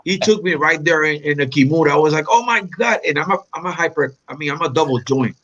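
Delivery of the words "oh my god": mock shock. Retold reaction to being submitted.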